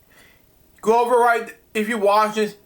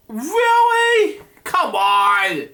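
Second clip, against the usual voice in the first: high squeaky really; shocked disbelief, pitched way up; disbelieving; 6 semitones higher, much slower than usual. Real, not acted. Reader shocked at the story, high disbelief.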